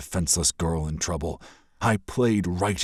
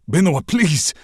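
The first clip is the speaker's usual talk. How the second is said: louder, urgent begging; pleading; 11 semitones higher, 8 dB louder than usual. Acted. Prince begs the princess to stay, urgent. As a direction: beg her not to go.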